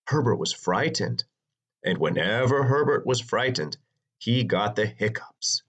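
ordinary delivery, nothing marked

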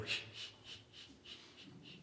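whispered shh, shh, very soft; smug shushing. Retold or imitated. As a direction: hiss a teasing hush at the siblings. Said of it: "and I'd go".

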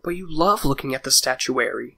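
ordinary delivery, nothing marked

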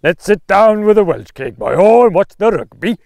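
hammed-up Welsh accent, high and loud; big, sing-song Welsh impression. Shown as a demonstration. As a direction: belt it out in mock Welsh with rolled R. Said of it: "this is a good Welsh phrase".

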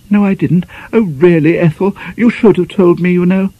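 high, fluttering, flustered lady's voice; dithering over the baking powder tin; fussy spinster mistress. Acted. Flustered mistress giving her maid orders.

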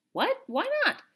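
very high, incredulous questions; high-pitched indignant disbelief; dismayed surprise. A narrator's reading. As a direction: squeak it out in protest. Boy dismayed at rejected tepee.

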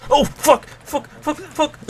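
high, loud panicked swearing; startled cursing. Real, not acted. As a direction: yelp it out in sudden fright. Startled by Jason spawning behind.

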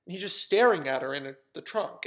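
usual speaking voice

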